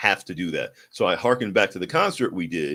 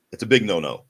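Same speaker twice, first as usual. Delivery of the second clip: usual speaking voice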